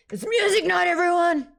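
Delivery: high, loud announcement to the crowd; big high-pitched hype yell; excited shout. Real, not acted. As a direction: holler it out to everyone. Calling out concert to everyone.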